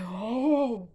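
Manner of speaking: high, loud gasp of an oh; shocked oh at the lie result. Retold or imitated.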